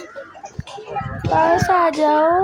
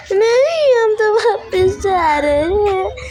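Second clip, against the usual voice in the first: much higher and louder, laughing no; laughing protest; 7 semitones higher, 5 dB louder, slower than usual. Real, not acted. Girl laughing as the family heads back from the zoo, half whining.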